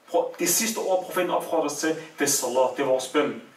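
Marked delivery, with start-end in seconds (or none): none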